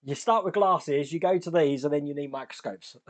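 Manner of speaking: ordinary delivery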